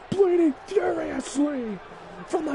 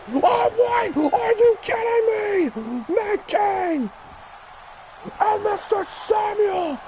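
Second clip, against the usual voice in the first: stunned excitement; 5 semitones higher than usual. Real, not acted. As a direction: erupt as the underdogs steal the titles. Surprise title win, commentator erupts.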